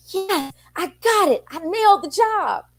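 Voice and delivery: high, bright burst of joy from the son; excited son. Retold or imitated. Son leaving the pizza interview, thrilled and high. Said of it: "Marshall just was in excitement".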